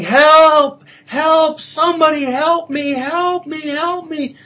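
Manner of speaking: high, loud desperate cries for help; frantic screaming, high and loud; panicked yelling. Acted. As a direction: yell for help hanging off a cliff. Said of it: "he starts yelling".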